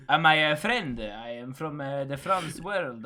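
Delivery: put-on slow, halting caricature French accent; mock French accent. Teasing a French Canadian friend with a mock French accent. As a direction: do a bad, hesitant French accent, softly. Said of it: "we don't sound like that at all".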